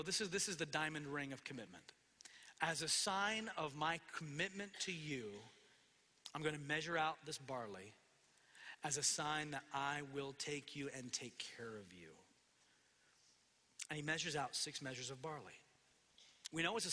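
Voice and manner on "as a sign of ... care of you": soft, hushed promise; tender pledge. Acted. Boaz pledges commitment to Ruth, tender and hushed. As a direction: sink gently into a near whisper.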